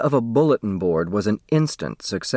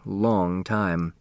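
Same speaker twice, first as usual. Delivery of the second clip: ordinary delivery, nothing marked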